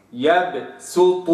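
يبسط with bounced ب, slow; slow Quranic يبسط; qalqala on baa. Shown as a demonstration. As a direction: let the ب in يبسط echo. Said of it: "هكذا".

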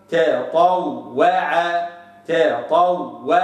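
تطوع very slow with the waw kept thin between heavy letters; thin waw demo. Shown as a demonstration. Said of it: "هكذا".

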